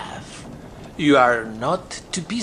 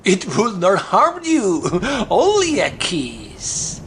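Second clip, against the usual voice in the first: pirate voice breaking into a sneering laugh; slower than usual. A narrator's reading. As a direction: laugh at her fear as you coax her.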